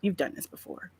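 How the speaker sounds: put-on soft, quiet child encouraging mom; mock little voice of calm reassurance; imitated reassuring son. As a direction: drop right down, gently coaxing. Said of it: "my son was like".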